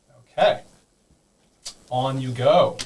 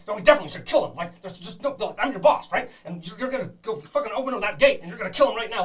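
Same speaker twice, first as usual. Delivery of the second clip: high, echoing bossy kobold demanding the kill; bossy kobold boss; 9 semitones higher, faster than usual. Acted. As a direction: bark orders in a high little monster voice. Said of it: "where it talks like this".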